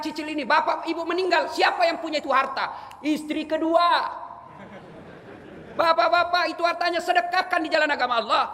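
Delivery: high, ringing exhortation; full-force preaching. Real, not acted. Preacher urges fathers to give to charity, impassioned high preaching.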